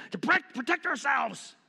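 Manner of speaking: high, strained caricature reply; hammed-up defensive outburst; mock panicky zealot. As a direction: blurt it like an alarmed gun enthusiast. Mocking the gun owners' answer.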